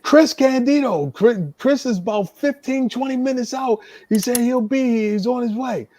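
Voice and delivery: high-pitched agitated promoter; Dennis Carluzzo. Retold or imitated. Promoter explaining the opponent is coming, high and agitated. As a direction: answer worked up, voice pitched well above your own. Frantic promoter answering, acted out.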